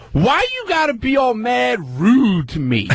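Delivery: high, slow mock indignant rep; imitating indignant customer service rep. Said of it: "And she just goes".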